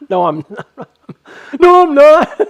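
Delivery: laughing denial building to a high shout; cracking up. Real, not acted. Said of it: "Sorry, I'm cracking myself up".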